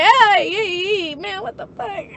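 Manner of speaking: high, loud hey hey; mock outraged yell. She yells at mom barging into her room, high mock outrage. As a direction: yelp at the intruder, half laughing. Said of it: "I'm like".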